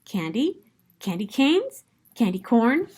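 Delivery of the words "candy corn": plain reading voice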